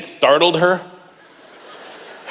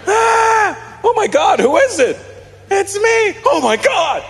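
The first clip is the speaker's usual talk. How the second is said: high, shouted mock panic; slower than usual. Kid falling down the stairs, high mock panic shout.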